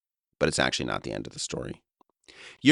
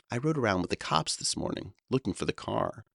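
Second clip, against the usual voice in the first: conspiratorial; 7 semitones higher than usual. Retold or imitated. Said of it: "he began conspiratorially".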